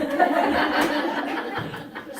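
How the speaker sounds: high burst of laughter; big real laugh; loud laugh. Real, not acted. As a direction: laugh out at your own joke. Laughs at own impression.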